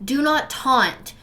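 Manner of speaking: ordinary delivery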